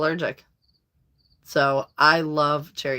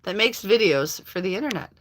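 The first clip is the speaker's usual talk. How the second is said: ordinary talk, nothing marked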